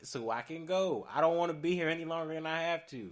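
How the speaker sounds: re-argue it, heated but half-performed; retold argument.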